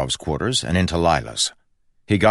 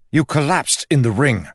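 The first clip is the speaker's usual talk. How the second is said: angry accusation; 10 semitones higher, 5 dB louder than usual. Acted. Kel furious at Lila.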